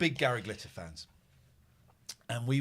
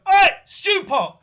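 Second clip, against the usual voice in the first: long, high, loud yell across the room; heckling shout; 18 dB louder, slower than usual. Retold or imitated. Teen fans heckling an actor in the interval, loud yell. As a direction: bellow it like a drunk teenage heckler. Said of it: "we went".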